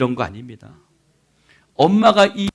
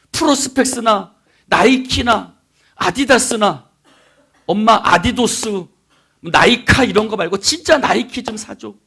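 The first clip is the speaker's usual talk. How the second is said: raised, emphatic imitation of a begging kid; pestering child; 7 semitones higher, 8 dB louder than usual. Boy begging mom for real Nike shoes, pestering. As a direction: plead for it brand by brand. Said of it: "엄마에게 신발을 사달라고 졸랐습니다".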